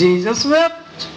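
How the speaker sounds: very slow, each word weighed; slow solemn quote. Acted. Preacher slowly quoting Jesus wept to the congregation. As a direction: let Jesus wept hang in the air.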